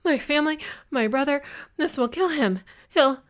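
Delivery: high, loud, rushed panic building to broken-off words; hysterical, breathless dread; spiraling panic. A narrator's reading. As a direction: spill it out frantically, climbing as you go. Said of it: "my panic spiraling higher and higher".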